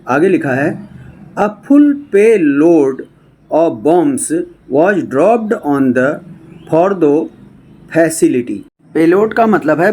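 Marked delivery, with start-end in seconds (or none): 1.4-8.6 slow, word-by-word English reading; read-aloud news text. Shown as a demonstration. Teacher reading a news report to students, slow read-aloud. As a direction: read it out slowly with gaps between phrases.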